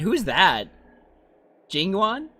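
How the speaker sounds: normal talking voice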